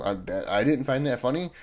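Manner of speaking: ordinary delivery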